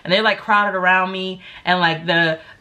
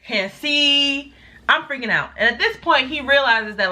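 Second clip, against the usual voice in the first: usual speaking voice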